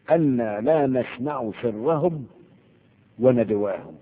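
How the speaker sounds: very slow, drawn-out chanted verse; verse recitation. Acted. Teacher quoting the verse for students, slow recitation. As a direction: stretch each word like Quran recitation.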